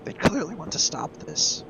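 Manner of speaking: ordinary talk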